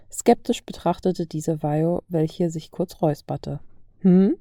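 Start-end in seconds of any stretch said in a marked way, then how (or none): none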